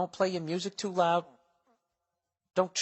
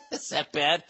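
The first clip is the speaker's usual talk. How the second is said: laughing; slower than usual. Real, not acted. Laughing mock question.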